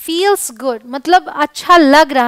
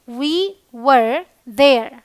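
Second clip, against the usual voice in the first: very slow, words pulled apart; slow teaching demo; much slower than usual. Shown as a demonstration. Teacher modelling we were there for Hindi students, very slow. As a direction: say it at a crawl for learners. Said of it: "हम वहाँ थे".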